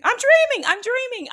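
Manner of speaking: very high, loud squeal of relief; hammed-up ecstatic squeal; insanely happy. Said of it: "I was insanely, like, happy".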